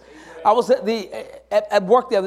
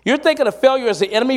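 usual speaking voice

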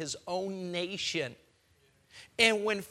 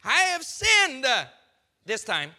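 mock confession; 4 semitones higher, 7 dB louder than usual. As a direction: ham up a fake sinner's plea. Preacher mocks Pharaoh's fake confession.

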